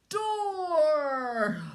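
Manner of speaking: put-on high loud baby dragon cry; wrong roar. Baby dragon gets the roar wrong, loud and high. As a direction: shout door like a silly baby dragon. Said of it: "Mama cries".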